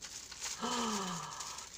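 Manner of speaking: long, soft intake of breath; real gasp of disbelief; astonished gasp. Real, not acted. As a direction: gasp softly at a surprise gift. Opens a surprise gift.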